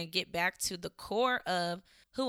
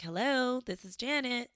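a hint of a polite, higher phone voice; mom's professional phone voice; 6 semitones higher than usual. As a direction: mom switches to her office voice. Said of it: "Where is that voice coming from".

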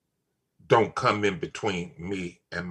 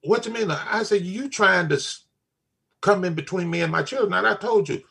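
a raised, firm retelling of the warning; firm retold warning; 7 semitones higher than usual. Father to daughter's friend, firm retold warning. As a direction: lay down the law again, a little higher.

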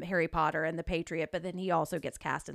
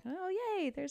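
high, soft fangirl squeal; soft, high-pitched glee at a favorite turning up; delighted little cheer; 11 semitones higher, 6 dB quieter than usual. Retold or imitated. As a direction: pitch leaps up, kept small and gleeful. Retold glad reaction on seeing him.

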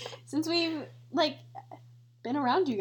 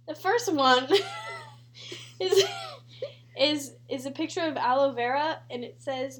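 usual speaking voice

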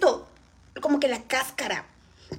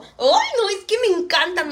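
excited squeal; 7 semitones higher, 6 dB louder than usual. Real, not acted. Gushing over pineapple.

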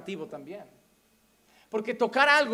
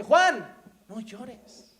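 elder comforting; 3 dB louder, much slower than usual. Acted. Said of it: "un anciano le dice".

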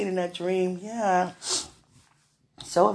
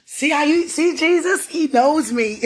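excited; 9 semitones higher, 5 dB louder than usual. Real, not acted. Excited praise, delighted.

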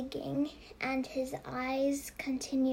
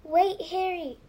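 louder, higher call after him; urgent call across the lawn; 5 semitones higher, 8 dB louder than usual. A narrator's reading. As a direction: shout to stop him. Calling after fleeing Harry.

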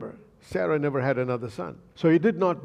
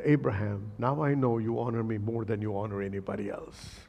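low, softer voice of God; 5 semitones lower, 4 dB quieter than usual. Acted. Preacher voicing God to Abraham, low and hushed. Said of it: "He said".

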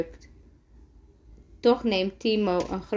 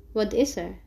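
plain reading voice

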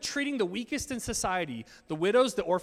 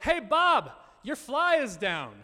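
loud and higher, slowing on the last words; mock call-out; 6 semitones higher, 7 dB louder, slower than usual. Guest calls out to the groom mid-ceremony, mock loud blurt. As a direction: blurt it across the aisle. Shouting at groom during wedding.

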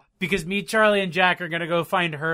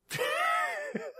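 very high, thin real laugh; helpless high-pitched laughing; squealed laugh; 6 dB quieter than usual. Real, not acted. Cracks up over owning a Kindle.

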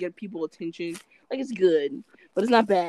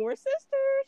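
reveal; slower than usual. Retold or imitated. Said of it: "I said".

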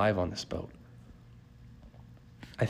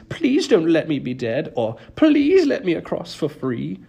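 high, louder mocking whine; mimicking whiny spirits; 7 semitones higher, 6 dB louder than usual. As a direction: whine like pestering ghosts. Charon mimics whining spirits.

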